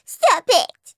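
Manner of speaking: high, mocking copy of a whiny child; mimicking. A narrator's reading. Henry copying his crying brother, mimicking. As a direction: parrot his plea to mock him. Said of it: "mimicked Henry".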